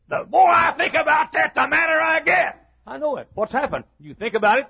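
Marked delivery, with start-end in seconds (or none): 0.1-2.6 shouted high and loud, boiling over; angry shout. Retold or imitated. Preacher shouting as the angry man in his sermon. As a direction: yell it like fury rising.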